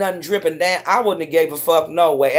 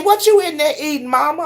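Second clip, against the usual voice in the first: mimicking a man; 7 semitones higher than usual. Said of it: "He said".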